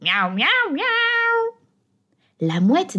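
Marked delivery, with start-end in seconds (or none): 0.0-1.6 much higher sweet cat voices; high, slow mewing cat chorus; calling cats. A narrator's reading. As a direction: meow and coax like hungry street cats. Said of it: "Les chats courent et crient".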